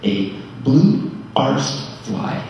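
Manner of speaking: "blue arsed fly" drawn out word by word; very slowly. Shown as a demonstration. Son carefully delivering Mom's cheeky saying to mourners, very slowly. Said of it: "I'll say this very slowly".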